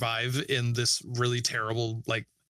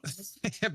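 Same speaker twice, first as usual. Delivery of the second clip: laugh; 6 semitones higher, 6 dB quieter than usual. Real, not acted. Laughing at the movie.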